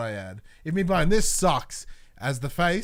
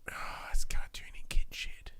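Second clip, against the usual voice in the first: whisper; 8 dB quieter than usual. Real, not acted. Dismissive aside, whispered.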